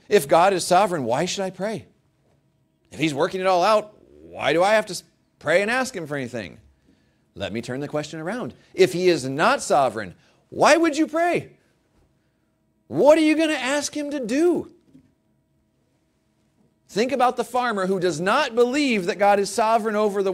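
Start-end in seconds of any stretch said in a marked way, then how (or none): 0.1-6.6 questioning voice. Retold or imitated. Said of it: "People have often asked the question". 8.7-19.1 high, loud preaching peak. Real, not acted.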